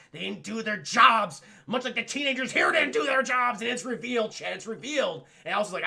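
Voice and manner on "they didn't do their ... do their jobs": loud, punched accusation; raised-voice retold rage; mock angry outburst. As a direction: imitate a bitter mother boiling over. Pamela rages about the teenagers.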